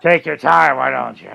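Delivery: low, husky chain-smoker voice; a hint of a raspy smoker; smoker's voice. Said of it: "cigarette smoking".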